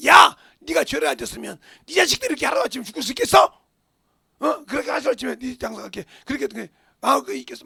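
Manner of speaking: loud, scolding friend berating Job; accusing shout. Acted. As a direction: yell the accusation at him. Friends rebuking Job in story. (욥을 꾸짖는 친구처럼 호통)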